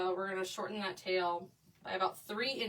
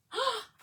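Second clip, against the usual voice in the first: touched gasp; 12 semitones higher, 3 dB louder than usual. Real, not acted. Touched by viewer's comment.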